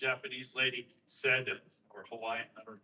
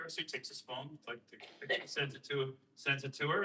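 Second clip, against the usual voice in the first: usual speaking voice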